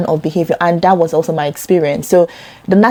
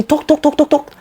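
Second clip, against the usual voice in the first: mocking chatter; 9 semitones higher than usual. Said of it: "mimics endless talk".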